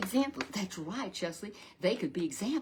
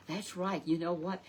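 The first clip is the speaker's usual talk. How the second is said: ordinary talk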